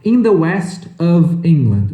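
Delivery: slow model phrase. Shown as a demonstration. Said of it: "detalhe de pronúncia".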